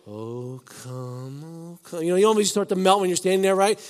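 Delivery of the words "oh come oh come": soft, drawn-out melancholy sing-song; slow melancholy. Shown as a demonstration. Pastor showing the church how mournful the hymn feels, slow and soft. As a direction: melt into it, slow and sad. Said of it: "such a slow, melancholy song".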